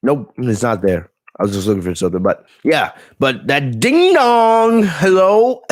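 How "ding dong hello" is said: high, slow sing-song ding dong hello; imitating Bayley. Host repeating Bayley's catchphrase, slow high sing-song. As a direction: stretch it out like a doorbell chime. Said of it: "Bayley catchphrase again".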